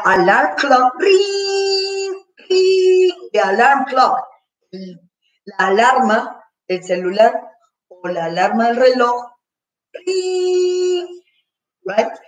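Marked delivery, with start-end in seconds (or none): 1.0-3.1 high, sustained ringing imitation; alarm clock ring. Shown as a demonstration. Teacher showing the class an alarm clock going off, high ringing. 9.9-11.2 long, high held ringing noise; alarm clock ring. Shown as a demonstration. Teacher explaining the alarm on a watch, high sustained ring.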